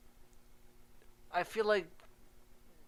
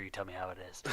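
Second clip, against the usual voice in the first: usual speaking voice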